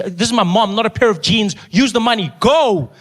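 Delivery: high, forceful mock fury; exasperated outburst. Son ordering nurse to use money, exasperated outburst.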